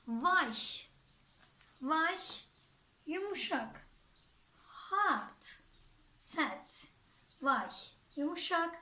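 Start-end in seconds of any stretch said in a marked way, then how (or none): none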